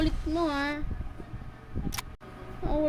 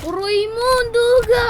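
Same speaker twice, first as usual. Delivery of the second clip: much higher, louder complaint; loud whiny protest; outraged; 9 semitones higher, 8 dB louder than usual. Real, not acted. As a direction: cry out at the cheat. Losing unfairly.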